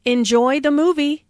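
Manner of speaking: "movie" lifted in a friendly rise; rising intonation. Shown as a demonstration. Teacher showing a friendly send-off, rise on "movie". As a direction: raise the pitch on "movie". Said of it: "intonation rises on movie".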